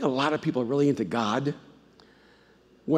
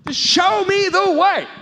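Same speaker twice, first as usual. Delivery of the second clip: crying out; 14 semitones higher, 4 dB louder, slower than usual. Retold or imitated. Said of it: "cried out".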